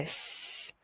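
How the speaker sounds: "S" as a light unvoiced hiss; soft hiss. Shown as a demonstration. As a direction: breathe "S" out softly. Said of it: "Nó xì nhẹ ra nha". (xì nhẹ âm "S")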